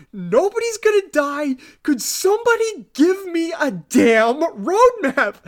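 high, loud mock-exasperated voice; exasperated Scully. Scully holds up her badge and calms the crowd, mock-exasperated and high. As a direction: play her fed up with the crowd. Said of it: "Scully's like".

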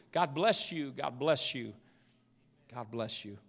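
fading from soft to very hushed; softened blessing. Real, not acted. Preacher repeats blessing to church, softly fading.

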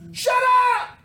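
explosive high, loud shout; screamed command to be silent; shouted rebuke. Acted. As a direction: shout him down at full voice. Crowd rebukes the blind beggar.

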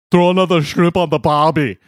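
Australian line in a hammed-up Austrian accent; Schwarzenegger-style Austrian accent. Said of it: "but it was like".

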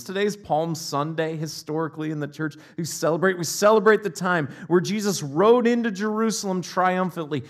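usual speaking voice

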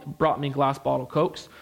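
usual speaking voice

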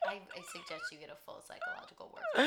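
mocking official. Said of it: "He's also like".

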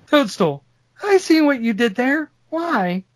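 half-hearted attempt at a girl voice; man doing a woman's voice. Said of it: "I'll try just for humor".

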